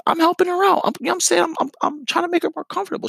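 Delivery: jumps way up into a high squeaky voice; squeaky falsetto, playing the nice guy; high mock voice. Sarcastic high voice describing helping.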